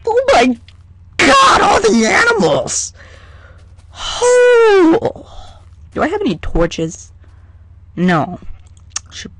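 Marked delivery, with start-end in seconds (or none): 0.1-5.3 much higher, louder shriek of delight; excited squeal. Real, not acted. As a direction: squeal at all the animals. Overjoyed at animals.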